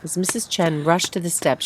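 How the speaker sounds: ordinary delivery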